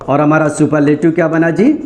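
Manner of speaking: usual speaking voice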